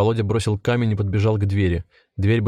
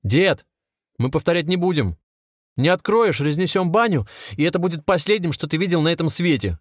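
high-pitched, angry ultimatum; shouted threat; 8 semitones higher than usual. A narrator's reading. Anatoly at the little window, shouting a threat. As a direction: threaten him with sharply raised pitch throughout. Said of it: "крикнул прямо в окошко".

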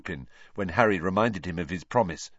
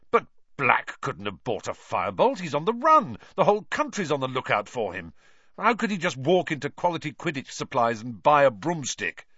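incredulous protest; 6 semitones higher than usual. A narrator's reading. Wood protests in disbelief.

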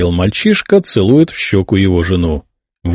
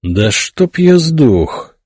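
astonished; 8 semitones higher, much slower than usual. A narrator's reading. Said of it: "изумлённо почесал затылок Теодон".

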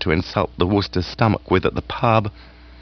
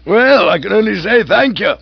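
higher, louder, gushing aged aunt; hearty aunt voice; 11 semitones higher, 4 dB louder than usual. Acted. Aunt gushing to Bertie about lunch. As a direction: raise the pitch and relish the food.

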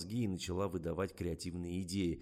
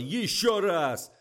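slow, high, very loud command; yelled order; 11 semitones higher, 11 dB louder, much slower than usual. A narrator's reading. Director demands another take, angry yell. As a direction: bellow each word for a retake. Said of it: "заорал Николай".

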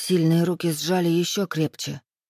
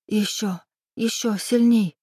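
higher, pleading whine; whining plea; 4 semitones higher than usual. A narrator's reading. Woman begging her lover for more, whining. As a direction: whine it, rising on the second plea. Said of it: "заскулила я".

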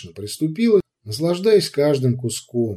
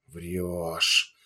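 growl; 7 semitones lower, 8 dB quieter than usual. A narrator's reading. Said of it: "прорычала Ягоза".

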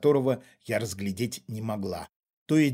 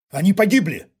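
loud, higher snap; angry bark; 6 semitones higher, 9 dB louder than usual. A narrator's reading. Father cuts off the intruder's accusations, angry bark. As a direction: bark it to shut him up. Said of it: "рявкнул отец".

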